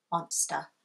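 usual speaking voice

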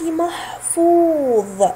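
محفوظ said slowly and deliberately; slow teacher demo of محفوظ; slow model word. Shown as a demonstration. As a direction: stretch محفوظ out for learners. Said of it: "فحرف الواو الأخير".

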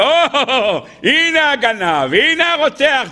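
high, drawn-out cry of discovery; mock accusing outcry. Horseman's family spots his clothes on Jacob, acted accusing cry. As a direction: call out the culprit, acting the accusers.

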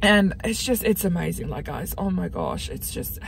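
really moved, fading as the words run out; getting emotional. Real, not acted. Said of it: "I'm getting emotional right now".

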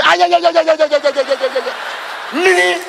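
high, loud stream of babbled syllables, sinking gradually; comic wailing outburst. Acted. Auditioner imagining wife with another man, comic babbling wail.

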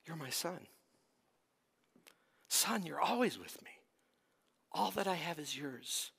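father gently pleading with angry son; tender appeal. Acted. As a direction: hush it like a loving father. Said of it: "He appeals to him".

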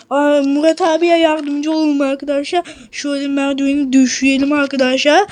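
normal talking voice

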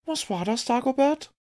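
high, curious female voice, rising on the name; a lady breaking in, startled and eager; surprised lady. Acted. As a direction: Violette cutting in on Dagobert, high and surprised. Violette interrupts the detective's story.

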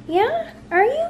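very high questioning coo. Real, not acted. Owner asking her puppy in high baby talk. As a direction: squeak it up high.

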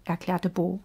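plain reading voice

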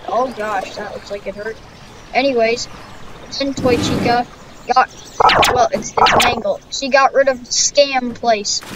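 usual speaking voice

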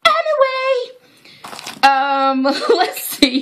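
high, amused anyway; high-pitched tittering anyway; giggly anyway. Real, not acted. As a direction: shake off the giggles. Recovering from laughter.

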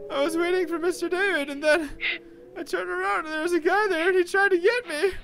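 put-on high, tearful little-boy voice; upset child Fluffy. Fluffy telling David what happened, upset child voice. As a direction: recount it like a shaken small child. Fluffy recounts his fright.

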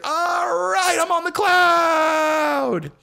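high, slow, stretched-out ecstatic yell; hammed-up euphoric howl; ecstatic shout. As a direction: belt it out like floating away. Ecstatic shout going back on drugs.

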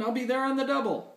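high, quick character reply; storybook hero rushing to help; eager rescuer. A narrator's reading. Hero answers the plea.

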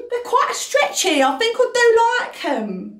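chirpy Essex babe; Essex accent. Pretend. Essex voice, far and high.

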